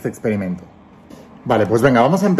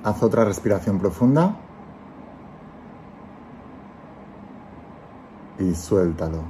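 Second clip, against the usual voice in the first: calm guiding voice, let each phrase settle; guided meditation; 3 semitones lower, 7 dB quieter than usual. Acted. Leads a guided visualization.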